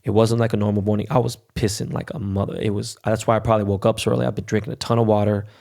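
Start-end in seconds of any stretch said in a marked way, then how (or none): none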